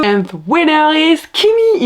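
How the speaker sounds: high, slow award-show announcer voice; mock winner announcement. To the viewers, crowning herself the winner with a gala announcement. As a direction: announce it like a gala host, drawn out.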